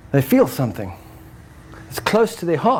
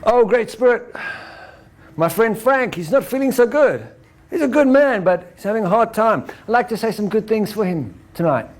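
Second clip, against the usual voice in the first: raised voice of a healer praying; shaman prayer; 4 semitones higher than usual. Retold or imitated. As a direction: call out to the Great Spirit earnestly. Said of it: "and say".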